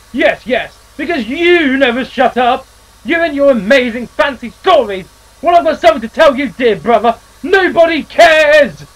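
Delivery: unhinged brother ranting at his sibling; deranged rant. Acted. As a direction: spit it out, unhinged. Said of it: "He actually sounded quite deranged".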